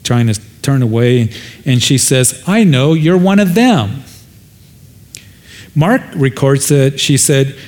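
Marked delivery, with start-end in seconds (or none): 2.5-4.0 put-on servant girl accusing.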